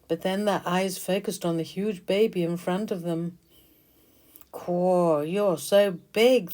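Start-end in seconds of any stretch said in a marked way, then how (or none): 4.5-6.5 gasping. Acted. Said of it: "they gasped".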